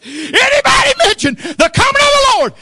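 high, loud cry of a preacher; fervent high cry at full voice; preaching shout. Acted. Preacher imitating Vernie's shouting.

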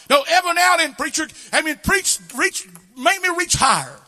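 high, loud, shouted revival preaching; full-force preaching. Acted. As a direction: preach it hard to lift the congregation.

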